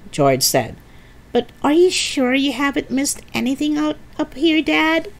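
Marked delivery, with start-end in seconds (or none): 1.3-5.1 put-on high-pitched child's query; lifted, questioning story child; doubtful young boy. A narrator's reading. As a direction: check with Dad in a small boy's voice. Voices the boy George.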